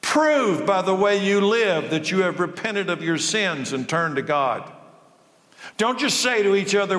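high, stern prophet's rebuke; John the Baptist scolding the Pharisees, fierce preaching voice; John the Baptist denouncing. Acted. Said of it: "He denounced them. "You brood of snakes," he exclaimed".

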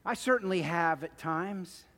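ordinary talk, nothing marked

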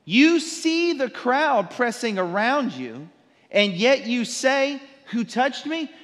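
slow, high, mocking disbelief; sarcastic disciples. Disciples mocking Jesus's question, sarcastic and slow. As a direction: drag it out like a sarcastic retort. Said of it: "they're being sarcastic".

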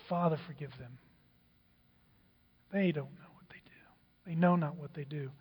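near-whispered plea for forgiveness; very soft and low, praying for them; hushed prayer. Acted. Jesus prays for his mockers.

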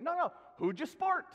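insistent mock Scottish, high and loud; Adam. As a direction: repeat it as an emphatic imitation Scotsman. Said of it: "he repeated it again".

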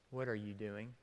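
hushed question. Retold or imitated. Preacher voicing God's question to listeners, hushed. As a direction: ask it gently as God would. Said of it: "God asking you".

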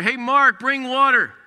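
retold yell. Boy yelling to his brother for water, loud. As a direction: shout for help with the fire. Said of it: "I yell to my brother".

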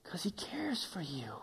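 slow, hushed whisper. Acted. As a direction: lean in and whisper it slowly. Intimate aside in sermon.

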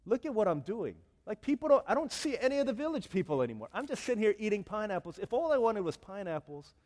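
loud, high acted self-reproach; worked-up ranting to oneself, hammed up; exasperated realisation. Missionary's sudden realisation, retold.

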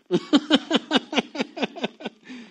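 loud high burst of real laughter; hearty real laugh; big laugh. Real, not acted. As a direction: laugh out loud at your own tease. Laughs after teasing Annika.

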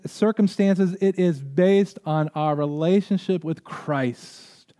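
loud, high preaching, Christ pressed home; fervent preaching. Acted. Pastor urging church to rejoice in Christ, fervent and loud. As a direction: preach the conclusion with conviction. Impassioned preaching, closing exhortation.

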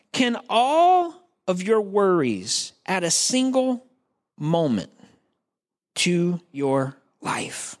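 drawn-out words with pauses; measured, emphatic scripture reading; slow, weighty reading. Real, not acted. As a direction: read the verse out deliberately, phrase by phrase. Reads the verse slowly for weight.

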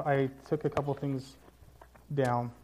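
ordinary talk, nothing marked

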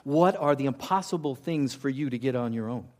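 usual speaking voice

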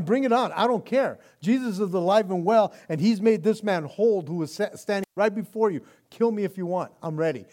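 loud, high, defiant declaration; defiant Peter. Retold or imitated. Peter defying the council that killed Jesus, shouting. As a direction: hurl it at the accusers, fearless. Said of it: "he's going".

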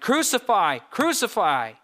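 loud, high cry of an angry crowd; shouting. A narrator's reading. Chief priests and officials demanding crucifixion, shouting. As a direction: cry it out like a mob demanding death. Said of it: "they shouted".

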